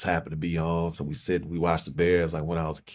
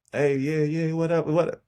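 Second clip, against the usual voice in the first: much higher, bright casual hey, yeah, what up; fake cheerful greeting; 11 semitones higher than usual. Greeting people out and about while hiding depression, forced cheer. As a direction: put on a happy mask for the crowd. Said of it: "good at wearing a mask".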